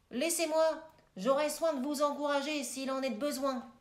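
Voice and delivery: Chrysale plus fort, indigné, face à sa fille; un père vexé qui affirme son autorité; père qui s'emporte. Acted. (le père qui se vante devant sa fille, plus fort)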